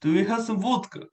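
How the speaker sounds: put-on playful Russian accent. Impressionist joining the accent game, playful Russian accent. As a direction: ask like a caricature Russian. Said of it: "Can you do a Russian accent?".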